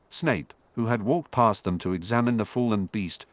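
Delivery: plain reading voice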